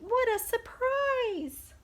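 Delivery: very high, loud and slow amazement; delighted exclaim. Acted. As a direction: savor the big surprise. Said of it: "cries Pete".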